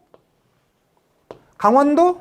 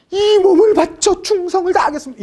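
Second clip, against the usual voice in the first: higher and louder, a solemn courtier vowing loyalty; earnest loyal pledge; 7 semitones higher, 3 dB louder than usual. Pretend. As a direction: vow it like a kneeling subject before the king. Imagined candidate answering king. (임금 앞에서 충성을 맹세하는 신하처럼 높고 크게)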